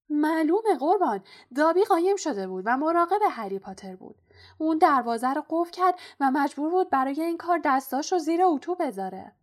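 high, loud, eager elf voice; Dobby the house-elf. A narrator's reading. House-elf admitting to Harry he blocked the gate, eager confession.

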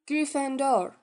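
the Sorting Hat announces Gryffindor to the hall; hat shouting the house. A narrator's reading. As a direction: proclaim it to the whole hall. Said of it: "آخرین کلمه را ... فریاد زد".

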